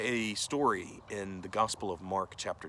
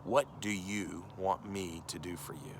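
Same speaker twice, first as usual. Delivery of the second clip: slow, deliberate quoted question; direct question. Retold or imitated. Jesus asking the blind man what he wants, slow and direct. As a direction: put it squarely, drawing the words out. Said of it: "But he asks him directly".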